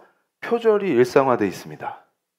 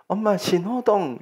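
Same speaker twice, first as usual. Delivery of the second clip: higher, slow imitated child; child's voice; 6 semitones higher, 3 dB quieter, slower than usual. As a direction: answer Mom like a child, drawn out. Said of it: "그러니까 아이가".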